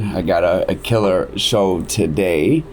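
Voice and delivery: ordinary talk, nothing marked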